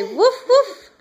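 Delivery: put-on playful bark for the baby; laughing dog bark imitation. Shown as a demonstration. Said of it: "Birdie say".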